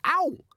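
sharp high ow; a quick pained yelp. Acted. Boy cuffed on ear.

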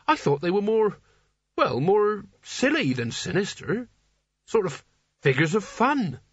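high, far-from-narrator character voice; shocked naive Nigel. A narrator's reading.

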